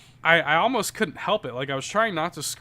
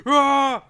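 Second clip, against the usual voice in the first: angry scream; 12 semitones higher, 9 dB louder than usual. Retold or imitated. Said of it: "My scream just sounds angry".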